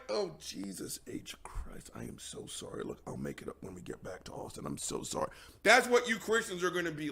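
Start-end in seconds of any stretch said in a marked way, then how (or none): 0.1-5.3 mortified whisper to the partner; hushed apology after the uncle's outburst. Pretend. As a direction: whisper it fast, deeply embarrassed. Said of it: "And you're just like".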